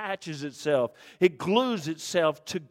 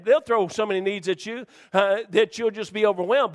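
ordinary talk

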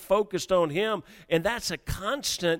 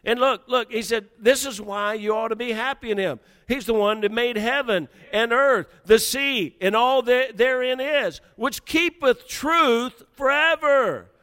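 higher and louder, building; fervent preaching; 6 semitones higher, 5 dB louder than usual. Acted. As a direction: drive it home to the church. Preaching at full force.

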